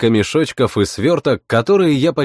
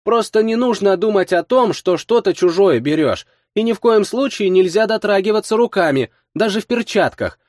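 put-on high, lively girl voice; girl explaining; 9 semitones higher than usual. A narrator's reading. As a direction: explain the trick like a young thief.

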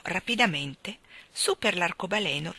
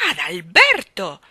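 loud, drawn-out scolding cry; reproachful; 11 dB louder, slower than usual. A narrator's reading. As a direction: call the name out in sharp reproach. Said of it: "lo rimproverò ancora la regina".

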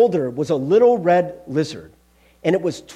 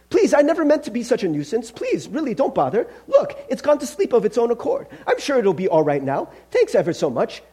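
higher-pitched, apologetic ghost voice; flustered, placating ghost; 6 semitones higher than usual. Acted. Ghost begging the angel not to bother, flustered. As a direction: beg off politely and nervously.

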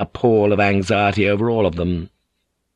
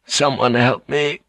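desperate plea; 4 semitones higher than usual. A narrator's reading. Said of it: "he prayed".

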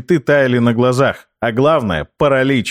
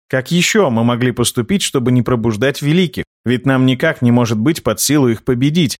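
usual speaking voice

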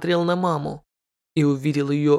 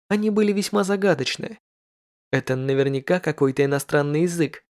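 usual speaking voice

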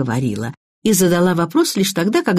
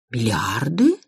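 bewildered question; 6 semitones lower, slower than usual. A narrator's reading. Mother repeats daughter's news of a fortune, bewildered. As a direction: echo it in lost disbelief, voice sliding up. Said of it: "растерянно повторила мать".

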